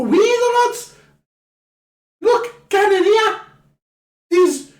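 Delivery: high, loud excited Scouse rant; Scouse accent. Acted. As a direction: gush like an overexcited Liverpool fan in broad Scouse. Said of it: "Gone scouse there".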